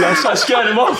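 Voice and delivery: mock boast. Mate's boast retold.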